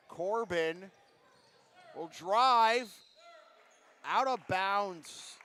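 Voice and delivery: loud, drawn-out call of the drive; urgent play-by-play. Real, not acted. Commentator follows Corbin's drive out of bounds, urgent call. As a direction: punch out the action as it happens.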